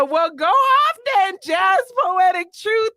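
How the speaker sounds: high, hyped-up praise; cheering read-out. Retold or imitated. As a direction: read it like you're cheering her on. Reads a cheering comment.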